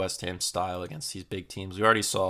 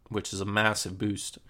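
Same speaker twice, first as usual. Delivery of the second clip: ordinary talk, nothing marked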